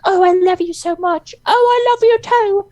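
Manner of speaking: very high swooning sappy voice; mock lovestruck gushing. Friend mocks Loki and Sylvie's romance, high swoony gush. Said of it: "Loki and Sylvie's like".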